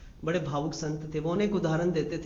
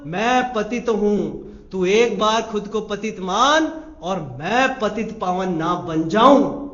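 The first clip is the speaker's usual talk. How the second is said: slow earnest; 5 dB louder, slower than usual. Shown as a demonstration. Slow humble confession.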